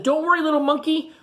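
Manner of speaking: put-on high sweet girl voice; little girl. A narrator's reading. Teacher voicing a little girl to George, high. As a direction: comfort the monkey like a small girl. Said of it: "A little girl said".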